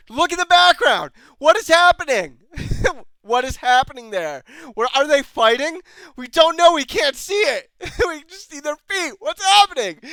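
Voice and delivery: high, loud, agitated rant; incredulous shouting. Real, not acted. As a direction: yell at the screen, totally baffled. Shouting in disbelief at background fight.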